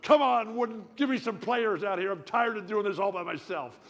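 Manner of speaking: loud, high complaining call; yelling at the coach. Retold or imitated. Player shouting his complaint at coach Wooden. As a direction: holler up the court at the coach. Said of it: "I'm up and down that court".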